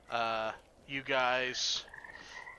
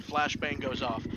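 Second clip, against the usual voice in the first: ordinary talk, nothing marked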